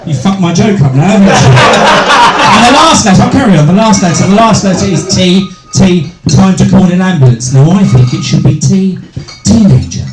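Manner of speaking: ordinary delivery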